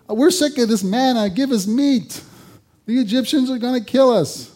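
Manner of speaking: high, loud complaining whine; mock the grumbling crowd; whining Israelites. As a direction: Israelites whining to Moses in the desert. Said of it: "We remember the complaints".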